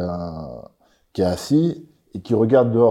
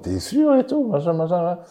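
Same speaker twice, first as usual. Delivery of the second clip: incredulous; 10 semitones higher than usual. Retold or imitated. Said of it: "On est là en mode".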